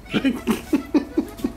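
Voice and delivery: airy unvoiced laugh; breathy laugh. Real, not acted. Laughing at his own joke.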